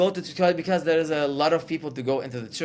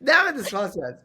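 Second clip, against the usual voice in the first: higher, louder playful cursing; mock exasperation; 6 semitones higher, 4 dB louder than usual. Guest cursing the podcast after blanking on a joke, mock exasperated. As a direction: curse the show in mock frustration.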